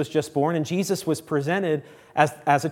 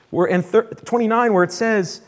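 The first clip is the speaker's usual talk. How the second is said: ordinary talk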